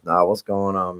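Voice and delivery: usual speaking voice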